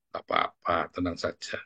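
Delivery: soft, low calming voice; acted reassurance. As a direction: gently settle someone down, quietly. Acting out gentle reassurance.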